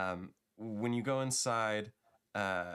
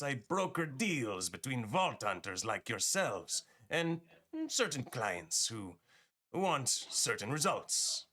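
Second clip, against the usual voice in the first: smiling merchant with a put-on accent; high, swooping dealer's pitch, slowing at the end; Marcus; 6 semitones higher than usual. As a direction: greet customers high-pitched, then draw out the sales talk. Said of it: "he smiles and says".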